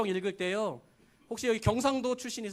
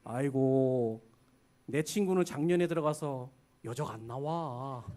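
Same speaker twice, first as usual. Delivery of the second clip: Chungcheong dialect warning; 7 semitones lower than usual. Retold or imitated. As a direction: warn swimmers in mock country dialect with a chuckle. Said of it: "경고의 말이 있어요".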